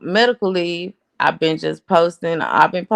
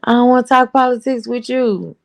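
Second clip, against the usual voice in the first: insistent repeat; 4 semitones higher than usual. Retold or imitated. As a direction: say it again, fed up and firmer. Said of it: "now I've been telling dude".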